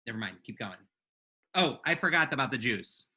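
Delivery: normal talking voice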